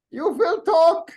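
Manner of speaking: mock interrogator. Joking command at the light.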